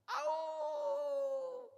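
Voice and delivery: long, high, loud howl; imitated wolf's "awoo". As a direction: howl like a distant wolf, drawn way out. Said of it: "늑대 소리가 나면".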